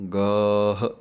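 ਗਾਹ as one syllable, long aa, light final h; correct pronunciation. Shown as a demonstration. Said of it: "ਇਹ ਸਹੀ ਉਚਾਰਨ ਹੈ". (ਗਾਹ ਸਹੀ ਉਚਾਰਨ)

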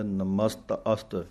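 ਨਮਸਤ ਅਸਤ with a light ਅ sound before ਸਤ; ਨਮਸਤ ਅਸਤ with the aira barely voiced; subtle aira sound. Shown as a demonstration. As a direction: let a faint ਅ slip in: ਨਮਸਤ ਅਸਤ. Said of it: "ਸੂਖਮ ਜਾਂ ਐੜੇ ਦੀ ਆਵਾਜ਼ ਵੀ ਆਉਂਦੀ ਹੈ".

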